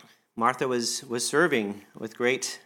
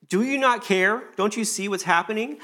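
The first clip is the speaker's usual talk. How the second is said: high and loud complaint; Martha's exasperated plea; 8 semitones higher, 5 dB louder than usual. Acted. Martha complaining to Jesus, high and exasperated. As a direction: demand to be seen, half-hurt. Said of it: "what's happening with, with Martha?".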